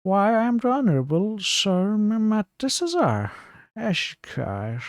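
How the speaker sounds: high, slow, grandly self-important; pompous gentleman. Acted. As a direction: announce your title like a puffed-up noble. Pompous title, self-introduced.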